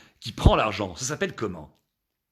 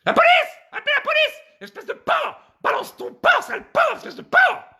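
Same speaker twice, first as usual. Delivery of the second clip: very high shrieking falsetto; hammed-up shriek for help; outraged woman; 5 dB louder than usual. Woman greeted in the street calls police, outraged shrieking.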